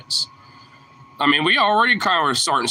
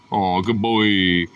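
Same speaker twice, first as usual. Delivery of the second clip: tender praise; 5 semitones lower, much slower than usual. Real, not acted. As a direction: melt over the dog.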